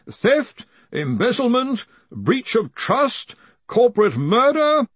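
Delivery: slow, high character voice; nervous reader. Acted. Researcher reading the message of the dead to a crowd, high and nervous. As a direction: read the accusation out haltingly and emphatically.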